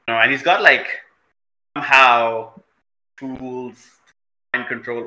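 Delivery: ordinary talk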